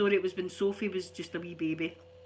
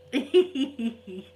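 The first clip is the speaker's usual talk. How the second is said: laugh; 2 semitones higher, 7 dB louder than usual. Real, not acted. Laughing at own story.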